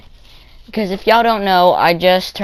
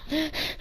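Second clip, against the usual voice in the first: mock sobbing gasp; 10 semitones higher, 14 dB quieter than usual. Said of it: "He's like".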